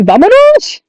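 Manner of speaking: high, urgent cartoon-alien shout of "Vámonos"; Zim yelling Vámonos. Zim hurrying his computer, high yelled "Vámonos". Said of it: "And he's like".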